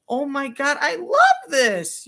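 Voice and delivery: high, slow, loud gift gush; fake delight. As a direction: overplay joy at a bad present. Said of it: "let me open up my Christmas present".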